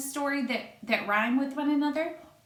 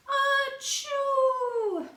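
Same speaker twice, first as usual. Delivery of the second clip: much higher, louder squeaky achoo; big sneeze; 3 dB louder than usual. Acted. Flea sneezing in the barn, high squeaky achoo. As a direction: let out a tiny creature's sneeze. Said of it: "before he could cover his snoot".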